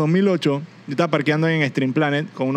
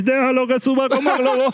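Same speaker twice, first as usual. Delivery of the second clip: higher put-on singer's delivery; singer impression; 6 semitones higher than usual. Friend suggesting a comeback for someone annoying, in a high mock singer voice. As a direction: throw the line out high, like a hook. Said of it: "tú le puedes decir".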